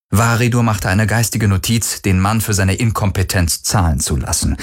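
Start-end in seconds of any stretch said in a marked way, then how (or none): none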